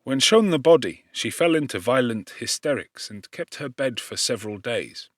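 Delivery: usual speaking voice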